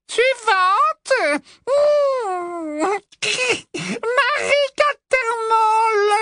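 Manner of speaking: high, slow sugary summons; calling out sweetly. Acted. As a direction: call the next victim. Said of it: "appela Ombrage". (appel doucereux et cruel)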